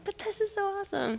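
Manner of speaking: high-pitched, softly gushing excitement; giddy protest in a high, small voice; excited. Retold or imitated. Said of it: "I'll freak out".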